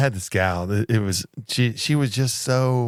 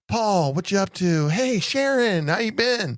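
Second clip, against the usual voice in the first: much higher, friendly calls to each passer-by; bubbly greeting imitation; 11 semitones higher than usual. Retelling a coworker greeting airport staff, high and friendly. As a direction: chirp the names out like she knows everyone.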